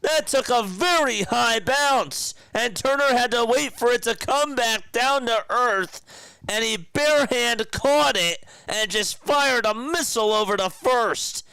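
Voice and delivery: high and loud, raving about the throw; thrilled announcer call. Real, not acted. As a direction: shout the play like a hyped broadcaster. Excited call of Turner's play.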